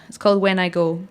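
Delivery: usual speaking voice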